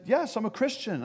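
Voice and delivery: high-pitched acted answer; calling back. Jumper to bystander on the bridge, calling back. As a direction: call the answer back across a gap.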